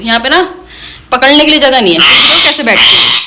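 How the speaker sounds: normal talking voice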